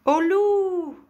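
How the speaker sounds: high, very loud drawn-out yell; cri d'alarme. A narrator's reading. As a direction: cry wolf at full voice. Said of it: "il criait". (cri aigu et très fort)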